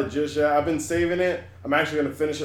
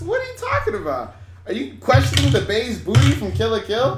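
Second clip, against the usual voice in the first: mock outrage; 7 semitones higher than usual. Mock outrage defending favourite show.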